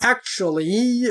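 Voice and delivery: slow, drawn-out "actually"; hesitant backtrack. Retold or imitated. Podcaster voicing the federation backing off after covid, drawn-out and hesitant. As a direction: stretch it out as if reconsidering an announced plan. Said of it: "they were like".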